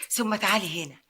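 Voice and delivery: usual speaking voice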